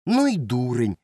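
loud, cutting dismissal; curt retort. A narrator's reading. Boy dismissing his friend as a fool, curt and loud. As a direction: cut him off with scorn. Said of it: "одрізав Сашко Циган".

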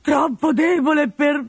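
grumbling Pantalone. Acted. Pantalone complaining to his servant, whiny old miser. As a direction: moan like a self-pitying invalid.

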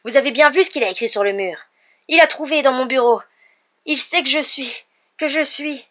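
high, loud, distraught tirade; agitated caretaker pleading his case at full voice; frantic accusation. Acted. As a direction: rant loudly, then stumble on the confession.

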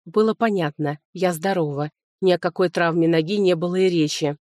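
ordinary delivery

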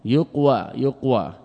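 "يقوى" said yuq-wa; syllable demonstration. Shown as a demonstration. Teacher pointing out the waw, "يقوى" split yuq-wa. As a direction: let the w sound clearly after the q in "يقوى". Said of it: "الذي هو الواو".

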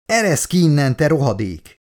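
angry shout. Acted. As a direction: spit it out at the man behind the door. Locked-in woman curses the pilot. (dühös kiabálás)

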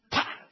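short explosive "pah"; imitated gunshot. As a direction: fire the shot with your mouth.

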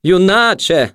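high, loud mocking hoot; high-pitched taunting exclamation; jeering junkie. A narrator's reading. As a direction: crow it in derision. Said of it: "засміявся наркоман".

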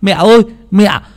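put-on high, loud child voices with laughs; excited kids shouting. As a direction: shout gleefully like little boys expecting a feast. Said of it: "chúng nó hô to".

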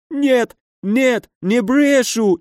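high-pitched, tearful protest; nearly crying. A narrator's reading. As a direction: plead as if about to burst into tears. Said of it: "чуть ли не заплакал барон". (чуть не плача, высоким голосом)

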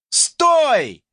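high, loud call of stop; a player calling after the ship; unsure shout. Acted. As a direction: yell it, not quite sure. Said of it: "неуверенно крикнул кто-то".

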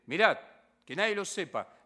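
"Mirad" barked out loud, then "que nadie lo sepa" firm; stern order. Retold or imitated. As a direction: give the order rigorously, not kindly. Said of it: "no, rigurosamente".

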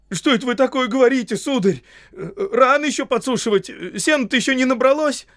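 very high, loud babble; comic frightened gardener; panicked denial. Acted. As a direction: squeal flustered excuses to the wizard. Frightened gardener denies eavesdropping.

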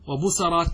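وبصرات as "wa-bu-sa-raat", a after the s; middle vowel a. Shown as a demonstration. Teacher gives three options to students, وبصرات as "wa-bu-sa-raat". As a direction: say وبصرات with a fatha on the second consonant. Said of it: "بالفتح".

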